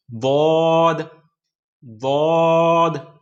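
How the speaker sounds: दौद slow and heavy, tongue to the side; teacher drilling daad; emphatic daad. Shown as a demonstration. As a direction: stretch out दौद heavily. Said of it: "उसी तरह से दौद".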